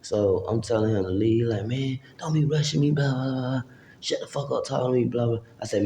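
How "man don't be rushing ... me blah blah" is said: higher-pitched imitation of a man refusing; pitched-up retold brush-off; mock irritated backtalk. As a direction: mimic his mouthy complaint. Acted quarrel, man refusing to leave.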